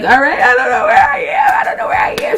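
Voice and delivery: high-pitched wail climbing into a squeal; mock crying panic. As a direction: re-enact being tearfully lost. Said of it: "you don't need to be crying".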